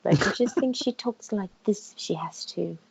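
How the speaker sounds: lightly voiced refined lady; delicate, ladylike put-on voice; proper lady. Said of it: "talks like a proper lady".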